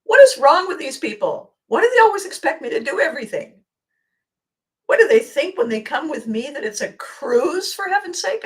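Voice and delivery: high, loud exasperated rant. Retold or imitated. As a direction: vent to a friend at full pitch. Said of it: "I said to my friend".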